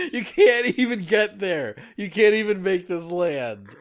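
loud and high, laughing. Real, not acted. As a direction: complain while laughing.

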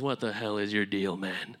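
usual speaking voice

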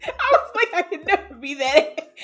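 high, loud laughing while talking; real laughter. Real, not acted. Telling friends about revenge, laughing hard. Laughing fit mid story.

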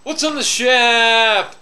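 loud, high, stretched-out call; shouted catchphrase. Pretend. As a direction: belt out the catchphrase, holding SHAP long and high. Shouted repeat of segment catchphrase.

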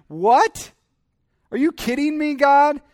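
high, loud, incredulous protest; acted outrage flung up at God; mad at God. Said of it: "They're more mad at God".

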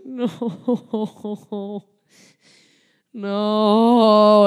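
a laughing, loud drawn-out no. Retold or imitated. Child laughing off the cousins' answer. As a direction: laugh through a horrified no.

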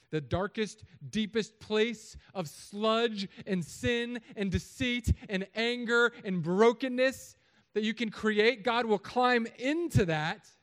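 very loud, high and slow, each phrase hammered; full-force preaching. Real, not acted. Preacher declaring how far God will climb down, full force. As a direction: let it build into a drawn-out pulpit cry.

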